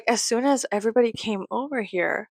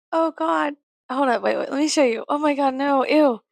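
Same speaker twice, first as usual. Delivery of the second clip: higher, louder rising alarm; shocked disgust; 6 semitones higher, 4 dB louder than usual. Real, not acted. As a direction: react to the ugly bag in horror. Discovers a flaw in the bag.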